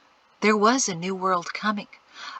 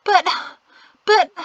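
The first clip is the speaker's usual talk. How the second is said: stammering; 11 semitones higher, 7 dB louder than usual. A narrator's reading. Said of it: "Priscille stammered".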